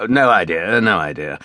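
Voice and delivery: mildly irritated, dismissive repeat. A narrator's reading. Captain answering the general's question, irritated. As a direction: brush the question off, dropping away on the repeat. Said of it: "apparently irritated by the question".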